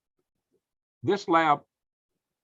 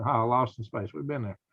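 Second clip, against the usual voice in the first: ordinary talk, nothing marked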